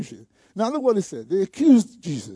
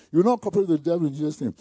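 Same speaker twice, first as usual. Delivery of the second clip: normal talking voice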